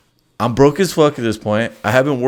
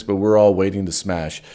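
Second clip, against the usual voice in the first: usual speaking voice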